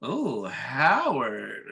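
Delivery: very slow, swooping "Oh, Howard"; swooning wife. Retold or imitated. Retelling wife admiring her husband, drawn-out swoon on "Howard". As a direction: stretch "Oh, Howard" into a mock swoon.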